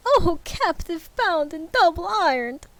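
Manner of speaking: high, wailing ghost; crying Phantom. Acted. Phantom cries out to Scrooge in high wail. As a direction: cry out in torment. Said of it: "cried the Phantom".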